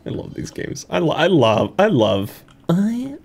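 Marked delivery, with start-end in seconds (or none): 0.1-0.9 whispered to himself, no voice. Real, not acted. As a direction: breathe it out as a secret. Quiet aside while playing.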